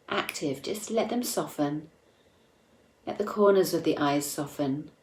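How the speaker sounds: soft, unhurried meditation voice with long pauses; guided meditation. Acted. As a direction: guide listeners inward gently, leaving space between phrases.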